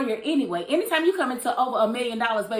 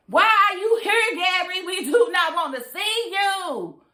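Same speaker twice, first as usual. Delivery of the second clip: mock scold; 8 semitones higher, 3 dB louder, slower than usual. Scolding character in mock quote.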